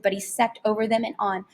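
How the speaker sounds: usual speaking voice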